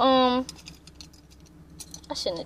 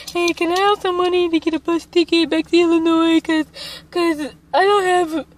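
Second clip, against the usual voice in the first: higher put-on asking voice; lightly pleading, raised pitch; retold phone plea; 5 semitones higher than usual. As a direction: act out begging a favour over the phone. Acts out calls begging bus money.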